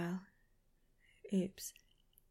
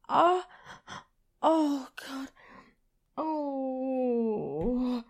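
louder, breathy cries of pleasure; aroused moan; 3 dB louder, much slower than usual. Acted. Lover moaning to a partner, rising cries. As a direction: let the moans spill out. Aroused gasps mid fantasy.

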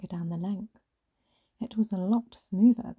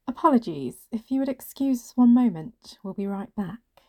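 raised, projected announcement; announcing; 4 semitones higher, 6 dB louder than usual. Acted. Colleague excusing herself to clients, announcing it. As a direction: announce it to the room, then ease off. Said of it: "Nadia announced".

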